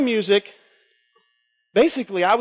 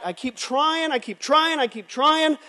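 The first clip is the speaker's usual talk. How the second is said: pleading repeat; 7 semitones higher, slower than usual. Retold or imitated. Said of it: "down on his face, saying".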